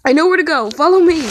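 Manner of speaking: urgent call to friends behind him; yelling. A narrator's reading. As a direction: shout it back while running. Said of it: "Matt yelled".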